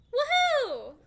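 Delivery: high, loud "woohoo"; drawn-out soundboard cheer; delayed cheer. Pretend. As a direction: whoop like a belated sound effect. Belated soundboard cheer as a gag.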